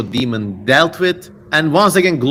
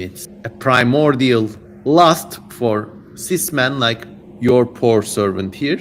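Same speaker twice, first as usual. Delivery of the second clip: ordinary delivery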